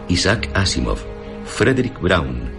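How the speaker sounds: ordinary talk, nothing marked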